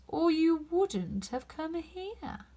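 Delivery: slow, drawn-out knowing cat; sly cat taking its time; lazy Cheshire Cat. A narrator's reading. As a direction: savour each word like a grinning cat.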